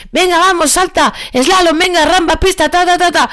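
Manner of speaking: high-pitched hurried agility commands, half-shouted; mock yelling at dog. Handler over-hyping her dog on the agility course, high mock yelling. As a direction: imitate an over-excited handler shouting cues. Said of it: "gritando en exceso al perro".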